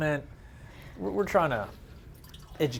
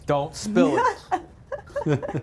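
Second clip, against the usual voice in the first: slow, drawn-out laughing warning; 8 semitones higher, 3 dB louder, much slower than usual. Real, not acted. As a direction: stretch it out through laughter.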